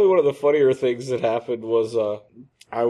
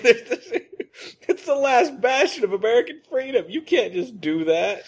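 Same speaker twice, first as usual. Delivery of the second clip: mock patriotic outrage; 11 semitones higher than usual.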